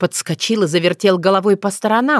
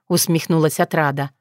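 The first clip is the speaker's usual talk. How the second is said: ordinary delivery, nothing marked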